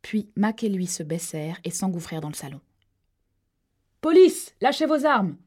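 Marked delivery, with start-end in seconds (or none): 4.0-5.4 much higher, much louder police shout; shouted command. A narrator's reading. Cops bursting in, shouting at the gunman. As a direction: bark it as you burst in. Cops storming the living room.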